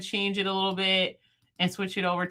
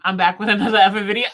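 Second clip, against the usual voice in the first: rapid, louder intro line; quick-fire video intro, loud; fast catchphrase; 7 dB louder, much faster than usual. Real, not acted. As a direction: rattle off the intro with energy. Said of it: "I can't say it slowly".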